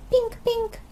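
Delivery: put-on high squeaky little-boy shout; shouting toddler George. A narrator's reading. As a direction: squeal it out like a tiny piglet. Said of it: "shouted George".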